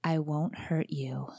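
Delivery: slow, unvoiced whisper; creepy whisper. Wife imitates the voice from the dark, slow whisper. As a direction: breathe it slowly like a lurking stranger. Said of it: "I hear".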